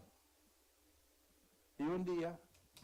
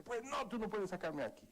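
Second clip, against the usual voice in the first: much higher defiant retort; retold defiant reply; 9 semitones higher than usual. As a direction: talk back to father, pitched up. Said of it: "ahora sí lo desafié".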